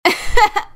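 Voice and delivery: big burst of high laughter; loud guffaw. Acted. Sister guffaws at the angry mare, loud burst of laughter. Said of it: "The other pony guffawed".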